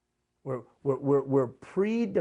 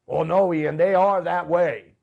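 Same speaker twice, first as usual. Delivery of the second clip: insistent objection; 3 semitones higher, 9 dB louder, slower than usual. Retold or imitated. Voices a listener's objection.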